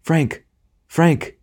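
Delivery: high, urgent calling of a name; lady calling her beloved to attention; ringing cry. A narrator's reading. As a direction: cry out, pitched well up. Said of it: "cried in a voice that made the whole wood ring".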